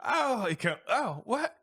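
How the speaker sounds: very high, startled voice; flustered banjo player. Acted. Banjo player startled by Skiff, high and flustered. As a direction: caught off guard. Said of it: "He goes".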